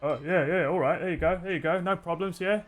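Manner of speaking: high, eager to please; nervous patron. Acted. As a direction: babble a nervous surrender. Second patron gives chair nervously.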